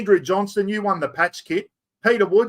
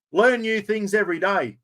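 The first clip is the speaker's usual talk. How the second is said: ordinary delivery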